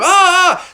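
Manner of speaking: loud, high acted scream; mock burning scream. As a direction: scream as if on fire, hammed-up. King catches fire.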